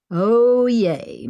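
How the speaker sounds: a delighted yay as the coffee arrives; happy exclamation when handed coffee; exclaimed. A narrator's reading. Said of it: "Maggie exclaimed".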